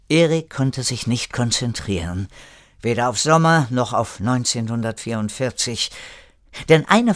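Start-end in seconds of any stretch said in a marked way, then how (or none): none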